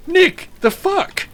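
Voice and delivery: very high, drawn-out squeal of disbelief; acted shocked disbelief. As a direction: retell it as incredulous falsetto. Retelling shocked reaction to friend.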